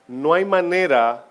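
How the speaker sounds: usual speaking voice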